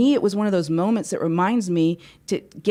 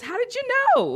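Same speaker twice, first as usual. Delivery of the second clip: high, startled voice of the man; surprised stranger. Retold or imitated. Seatmate asking how she guessed, in a high surprised voice. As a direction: squeak it out in amazement.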